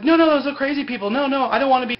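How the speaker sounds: high, loud mock panic; panicked protest. Retelling Locke begging off the crazy people, in mock panic.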